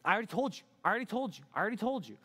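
high, fed-up singsong repeat; exasperated repetition. Acted. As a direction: drum it in like you're sick of repeating it. Acted fed-up man repeating himself.

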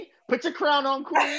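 high, laughing hype-man cheer; excited hype. Real, not acted. Hyping her up, laughing.

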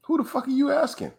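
high, exasperated comeback; incredulous retort. Retold or imitated. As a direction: shoot back in disbelief. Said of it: "And I'm like".

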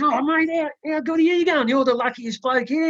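a pub punter marvelling at a lucky winner; excited punter. Retold or imitated. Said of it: "the bloke next to him says".

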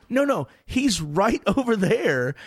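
pushing him to go over; insistent excited cashier. Retold or imitated. Cashier insists, star nearby.

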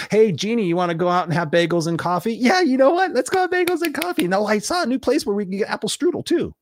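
high-pitched mock-excited chatter; hammed-up, squeaky enthusiasm; eager friends chatting. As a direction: play giddy friends tempted by treats. Acts out eager friends planning bagels.

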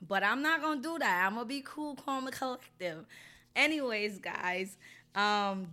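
usual speaking voice

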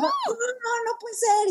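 dad can't believe it; imitating a stunned father; retold disbelief. Dad hearing the Taylor news.

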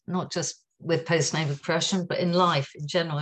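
ordinary delivery

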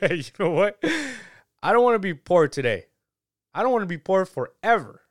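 high, loud, quick burst of mock excitement; mock excited. A poor person declaring he won't be poor, mock excited. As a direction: gush it like a sudden wish.

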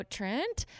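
TRENT said high with a rising question. Retold or imitated. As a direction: lift "Trent" into a tentative query. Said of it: "with a question mark".

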